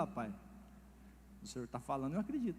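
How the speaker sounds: lightly voiced son, low and soft; son's reply. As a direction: meekly reassure his dad. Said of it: "Ele falou".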